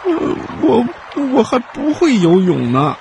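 high halting fawn, a touch of fear; trembling. Acted. Reindeer afraid of the river, mild trembling stammer. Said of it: "哆嗦起来".

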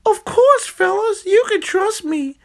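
very high, sly cartoon villain; a squeaky scheming hamburger.

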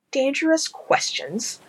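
high, slow disbelief; incredulous. Acted. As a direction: repeat it in disbelief. Incredulous echo.

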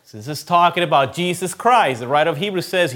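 normal talking voice

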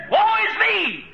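high anguished shout; crying out. Acted. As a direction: cry out woe in awe. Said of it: "He cried out".

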